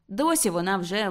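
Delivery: plain reading voice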